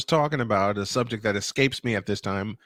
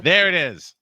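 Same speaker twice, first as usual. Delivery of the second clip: higher, louder triumphant call; excited; 4 semitones higher, 5 dB louder than usual. Acted. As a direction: announce it with a cheer. Second take higher louder.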